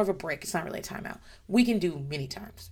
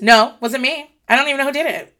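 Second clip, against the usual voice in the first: put-on quick child denial; 7 semitones higher, 10 dB louder than usual. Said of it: "Bunny's reflexive lie".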